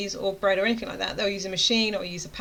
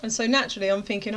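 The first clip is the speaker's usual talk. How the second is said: ordinary talk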